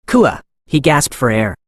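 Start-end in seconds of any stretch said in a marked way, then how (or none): 0.1-0.4 sharp high cry of pain; scream of agony. A narrator's reading. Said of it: "screamed in pure agony".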